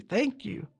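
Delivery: a soft, choked thank-you, dropping away; tearful whisper of thanks. Retold or imitated. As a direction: let the thanks crack and fade. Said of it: "He's so excited".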